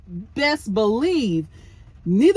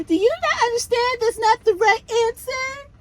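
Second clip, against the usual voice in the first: put-on much higher little-kid voice; baby voice; 13 semitones higher than usual. Host mocks Kimmy quizzing Maurice in a baby voice. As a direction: put on a childish voice, like a little girl. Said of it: "trying to talk like this little kid, baby voice".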